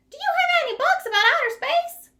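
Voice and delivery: very high, loud bird voice; chirpy Bird. Acted. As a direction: tweet the question up high. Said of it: "said Bird".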